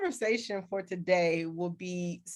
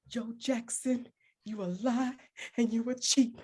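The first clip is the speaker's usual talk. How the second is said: Angela Bassett; 3 semitones higher than usual. Acted. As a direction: start breathy, then build insistently on each repeat. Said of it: "I was Angela Bassett, and I, I embodied her".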